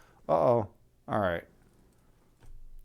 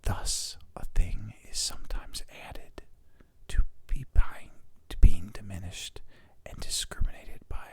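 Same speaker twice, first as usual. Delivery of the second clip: intimate whispered reading; ASMR whisper; 6 semitones lower than usual. Shown as a demonstration. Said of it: "doing the A S M R thing".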